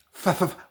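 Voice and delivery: "fa" with unvoiced lip-teeth hiss; soft breathy "fa"; "fa" with no voice, only air; voiceless f. Shown as a demonstration. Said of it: "pueden confundirla con F".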